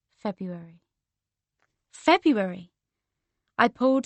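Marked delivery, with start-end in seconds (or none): 0.1-0.8 low-key, softly dropped word; quiet realisation. Acted. Repeats name as realisation dawns. 1.9-2.7 emphatic realisation. Acted. Repeats it, now emphatically.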